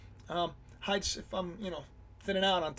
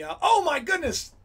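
excited outburst; 9 semitones higher, 7 dB louder than usual. Real, not acted. Sudden realisation mid-explanation.

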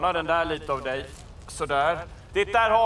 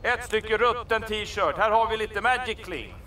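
sales pitch; 3 semitones higher than usual. Acted. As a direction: announce the rescue like a salesman.

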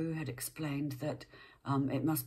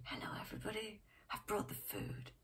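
a beaming chief, whispered softly; 5 semitones higher, 11 dB quieter than usual. Acted. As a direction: greet everyone softly and kindly. Chief voiced arriving with food.

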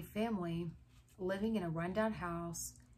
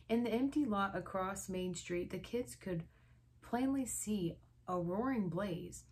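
ordinary delivery, nothing marked

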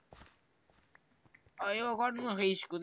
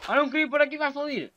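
excited; 7 semitones higher, 8 dB louder than usual. Real, not acted. Spots a creeper while playing.

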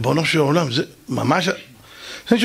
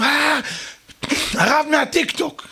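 high-pitched mock crowd cheer; excited crowd cry; 8 semitones higher, slower than usual. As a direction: hail a celebrity as the whole crowd. Crowd greets the rabbi's entrance. (קריאת התלהבות של קהל)

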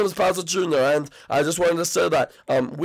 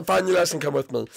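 ordinary talk, nothing marked

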